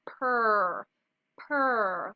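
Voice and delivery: "purr" as a drawn-out pr blend; isolated beginning sound. Shown as a demonstration. Teacher has kids listen for a blend, held pr sound. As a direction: say "purr" twice, held, with a pause between. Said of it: "listening for that beginning sound".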